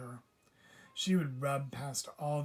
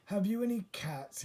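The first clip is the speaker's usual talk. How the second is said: higher, hopeful question; old man's hopeful raised question; eager old man asking; 6 semitones higher, 3 dB louder than usual. A narrator's reading. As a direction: ask with lifted hope. Mr Putter asks shelter man.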